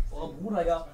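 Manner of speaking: ordinary talk, nothing marked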